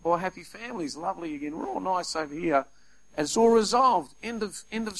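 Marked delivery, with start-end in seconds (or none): none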